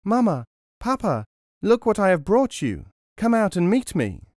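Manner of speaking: high excited call from the road. A narrator's reading. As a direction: call parents out to see the gold. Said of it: "he called out".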